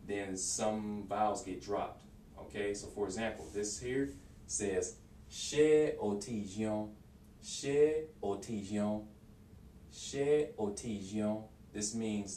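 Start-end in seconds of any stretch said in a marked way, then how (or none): 5.3-11.4 "Ṣé o ti jẹun?" slowly, every vowel kept; careful, drawn-out "Ṣé o ti jẹun?"; slow full form. Shown as a demonstration. Teacher says the full form slowly before the fast one. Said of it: "when they say it fast".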